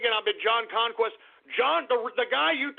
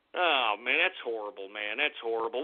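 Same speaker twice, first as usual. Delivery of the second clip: low, soft groan of disgust; disgusted mutter; 12 semitones lower, 6 dB quieter than usual. Real, not acted. Reacting to a chat name.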